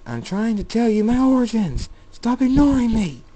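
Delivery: loud, high-pitched angry yell; exasperated shout. A narrator's reading. David demanding that Jacob stop ignoring him, exasperated shout. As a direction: shout to be listened to.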